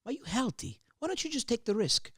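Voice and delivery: hushed, odd fatherly advice. Retold or imitated. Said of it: "He goes".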